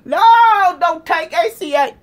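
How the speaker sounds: high, loud drawn-out wails; exaggerated sobbing protest at full volume; mock crying. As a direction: bawl and plead, hammed-up. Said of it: "Now y'all crying".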